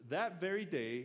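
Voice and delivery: "That" punched high and loud, "very day" falling away; "That" hit high and strong, the rest dropping; stress on THAT. Shown as a demonstration. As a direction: lean hard on "That" to open the verse. Reads the opening verse aloud.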